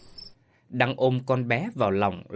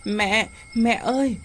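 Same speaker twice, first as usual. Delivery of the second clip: high, slow, loud wail for mother; child's scream; 9 semitones higher, 4 dB louder, slower than usual. A narrator's reading. Little girl screaming for her fallen mother. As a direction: cry out as mother falls. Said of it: "Ngọc Mai hét lên".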